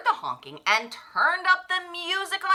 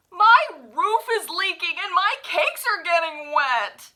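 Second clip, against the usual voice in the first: moaning baker; 6 semitones higher, 6 dB louder than usual. A narrator's reading. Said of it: "he moaned".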